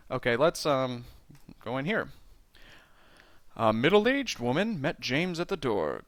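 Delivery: normal talking voice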